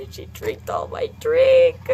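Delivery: high, drawn-out whiny cry; mock wailing. Student mourning her stolen drink, high mock wail. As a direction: wail it slowly, hammed-up sobbing.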